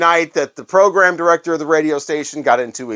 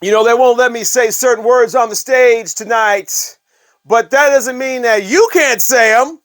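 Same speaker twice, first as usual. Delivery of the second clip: imitating the rock singer; 9 semitones higher, 5 dB louder than usual. The host imitating the singer teasing the crowd. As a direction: egg the crowd on like a frontman. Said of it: "he's like".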